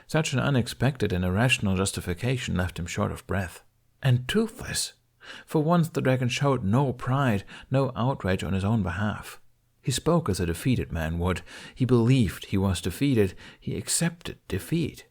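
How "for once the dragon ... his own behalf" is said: plain reading voice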